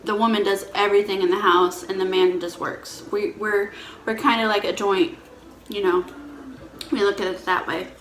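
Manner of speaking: normal talking voice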